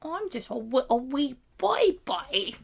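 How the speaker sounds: put-on slow, high British-tinged baby voice; baby voice sliding British. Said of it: "it turned British a little at the end".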